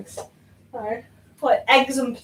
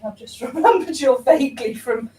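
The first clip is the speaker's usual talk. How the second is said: ordinary delivery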